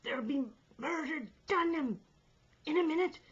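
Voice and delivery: high, loud, broken warning; breathless shout. A narrator's reading. Stubbins warning of murder, breathless shout. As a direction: shout it between caught breaths. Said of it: "catching his breath between the words".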